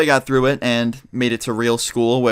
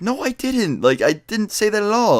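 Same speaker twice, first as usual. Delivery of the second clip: put-on much higher, indignant kid's protest; protesting denial; 9 semitones higher than usual. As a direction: retell a child's squeaked objection, pitched way up. Retold protest to accusing teachers.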